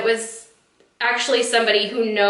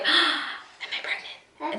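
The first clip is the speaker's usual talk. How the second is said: hushed gasp; 6 semitones higher, 8 dB quieter, much slower than usual. Retold or imitated.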